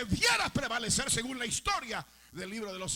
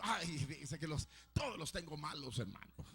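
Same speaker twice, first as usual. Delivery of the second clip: softer, lower whiny excuse; mock excuse; 7 semitones lower, 7 dB quieter than usual. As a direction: play the stingy brother dodging. Mocking another excuse.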